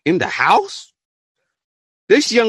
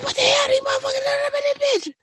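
shrieking disbelief; 5 dB quieter than usual. Retold or imitated. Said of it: "And I said".

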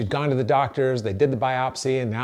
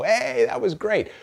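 higher, slow, happy little cheer; pleased exclaim; 10 semitones higher, slower than usual. Retold or imitated. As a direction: cheer it like a small win. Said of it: "we go".

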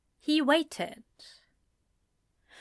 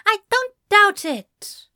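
sharp, loud, high retort; half snapped; 11 semitones higher, 9 dB louder than usual. A narrator's reading. Ezra snapping back at Anthony, sharp and loud. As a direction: snap it back, stung. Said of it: "Ezra half snapped".

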